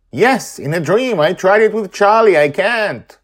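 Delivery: put-on high, loud upset girl; upset girl shouting. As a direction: shout it back like a frustrated child. Acted argument, girl.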